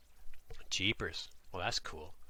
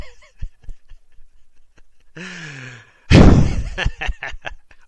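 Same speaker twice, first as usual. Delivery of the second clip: high, loud burst of laughter; helpless loud laughter; 9 semitones higher, 21 dB louder than usual. Real, not acted. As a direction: crack up at the joke. Laughing at own dialect demo.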